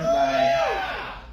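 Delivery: high loud call-out; shout. Real, not acted. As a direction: call out to the crowd. Calling out to audience.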